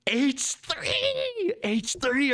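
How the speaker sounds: very high, thrilled call of the move; excited discovery. Real, not acted. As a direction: shout out the move in delight.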